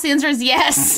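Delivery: normal talking voice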